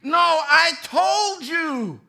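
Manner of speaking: slow, loud insistence; insistent wife. Retold or imitated. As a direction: drag it out, certain. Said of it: "she swears".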